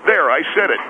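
usual speaking voice

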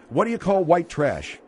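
ordinary talk, nothing marked

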